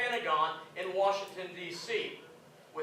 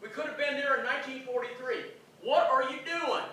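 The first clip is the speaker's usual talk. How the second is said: much higher and louder, ranting in disbelief; furious outburst; 8 semitones higher, 5 dB louder than usual. Retold or imitated. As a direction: blow up at your commanding general. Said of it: "he gets in front of Eisenhower and he goes".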